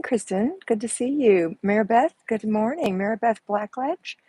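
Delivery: usual speaking voice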